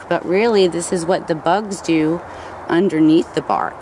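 ordinary delivery

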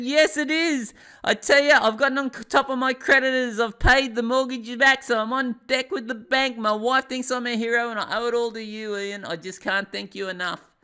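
ecstatic, shouting caller; overjoyed shout. Retold or imitated. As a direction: yell his name down the phone like a man saved. Grateful client calls overjoyed.

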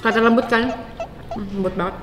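chewing while talking; talking between bites; mouth full. Real, not acted. Seen 0: Holds meat at camera; cheek bulges, lips pressed mid-chew around +0.6.

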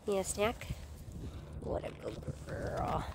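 soft whispered praise for dog. Real, not acted. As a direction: hush and coo at the dog.

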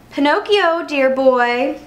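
a hint of smooth trickster; sly flatterer. A narrator's reading. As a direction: hail the boy with false fondness. Sly fox hails the boy.

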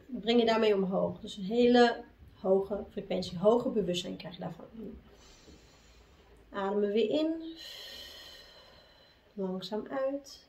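low, soft meditation voice; hushed, unhurried guiding between chants; guided breathing. Real, not acted.

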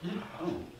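very soft, halting near-whisper; hushed, stunned stammer; dumbfounded Peter. Acted. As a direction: stammer it under the breath, at a loss. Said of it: "Peter's just like".

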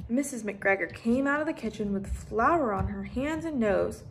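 ordinary delivery

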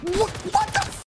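startled high-pitched outburst; shocked. Real, not acted. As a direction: caught off guard, voice jumps up. Sudden enemy attack in game.

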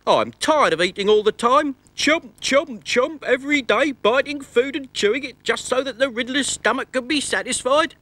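high squeaky grumbling tooth. Acted. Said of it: "said one of his front teeth".

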